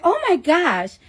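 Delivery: light imitation of a high, girlish Staten Island gasp. Imitating her OB-GYN reacting to the sonogram, a light high Staten Island girl voice.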